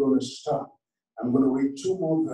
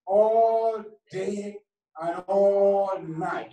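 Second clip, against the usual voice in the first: slow, drawn-out scripture call; measured, sustained preacher's reading; declaimed scripture; 4 semitones higher, 4 dB louder, much slower than usual. Real, not acted. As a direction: stretch each word out for the congregation.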